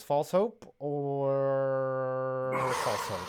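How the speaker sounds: "False hope or false hope?" dragged out slowly, deadpan; slow drawn-out mock question. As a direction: stretch every word as a mock dilemma. Joke choice for the Panthers.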